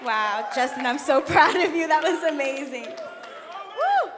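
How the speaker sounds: laughing, loud and thrilled; excited praise. Real, not acted. Praising Justin right after his talk, excited and laughing. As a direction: gush over him with a laugh.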